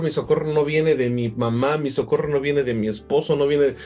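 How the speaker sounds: usual speaking voice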